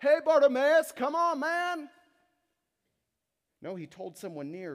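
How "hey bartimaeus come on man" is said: much higher and much louder, a far-off shout; yelling across a crowd. Retold or imitated. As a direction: holler over a noisy crowd to someone far away. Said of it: "Jesus didn't yell back".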